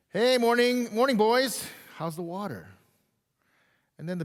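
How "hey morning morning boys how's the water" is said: bright, high, loud hammed-up fish hailing the little ones; big fish greeting. Preacher voicing the bigger fish greeting two little fish, loud and cheery. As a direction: call out cheerily like a jovial old fish. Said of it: "the bigger fish comes by and says".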